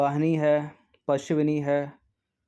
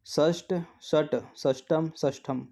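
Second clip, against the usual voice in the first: slow; slower than usual. Shown as a demonstration. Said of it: "spelling options read slowly".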